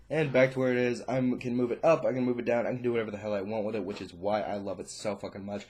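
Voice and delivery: ordinary talk, nothing marked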